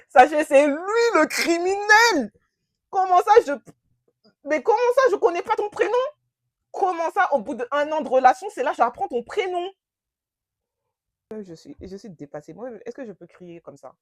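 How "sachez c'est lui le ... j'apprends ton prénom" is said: loud, high, outraged screech; outraged shrieking. Retold or imitated. To the audience, reliving her fury at the lying boyfriend, outraged shriek. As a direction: scream your disbelief at him. Outraged at learning his name after a year.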